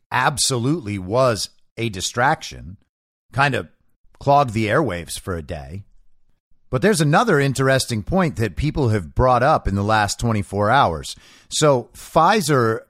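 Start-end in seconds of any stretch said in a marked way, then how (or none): none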